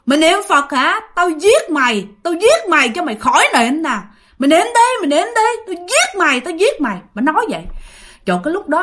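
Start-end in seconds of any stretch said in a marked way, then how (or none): 0.0-7.0 high, menacing tirade; cursing ghost woman. Acted. Ghost woman curses while choking her praying victim, high angry threats. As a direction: threaten them angrily, pitch climbing. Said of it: "bà vừa bóp bà vừa chửi nha".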